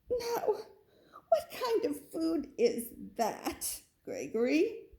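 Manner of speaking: high, slow disbelieving mother goat; mother goat, shocked and slow; mother goat asking. Acted. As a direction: ask slowly, amazed at the odd food. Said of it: "she asked".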